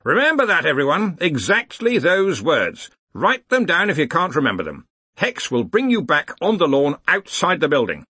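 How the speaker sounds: commanding, lifted voice. A narrator's reading. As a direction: address the whole hall with authority. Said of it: "He raised his voice".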